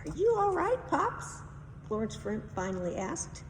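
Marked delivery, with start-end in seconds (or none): 0.1-1.4 put-on high, loud girl's voice asking; a girl checking on her dad; worried daughter. Florence asking her dad if he's all right, high and worried.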